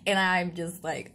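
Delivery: ordinary talk, nothing marked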